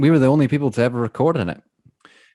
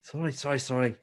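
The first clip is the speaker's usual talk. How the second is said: quiet apology; 2 semitones higher, 9 dB quieter than usual. Real, not acted.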